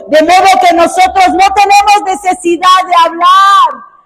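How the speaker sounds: shouted high, emphatic reading; full-voice pulpit cry; loud proclaiming. Shown as a demonstration. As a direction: shout the verse out to the crowd. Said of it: "repeats line quietly".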